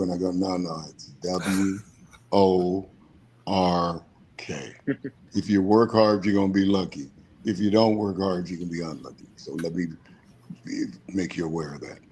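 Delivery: usual speaking voice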